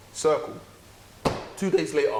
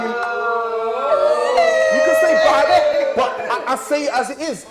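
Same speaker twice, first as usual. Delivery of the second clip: loud, raised, slowed-down shout; heated shout; 9 semitones higher, 9 dB louder, much slower than usual. Real, not acted. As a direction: bellow it out to defend himself. Defending his harsh response.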